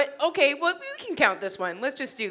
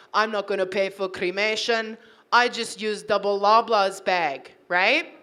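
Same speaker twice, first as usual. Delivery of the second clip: imitating an Italian husband, lower at first; Italian accent; 4 semitones lower than usual. Husband to wife after the cat died, Italian accent. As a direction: do his matter-of-fact Italian accent. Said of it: "he says it in the accent".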